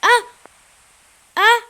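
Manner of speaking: loud gamer yells; sudden loud cries; short shouts. Real, not acted. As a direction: yell in the heat of play. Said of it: "désolé si je crie".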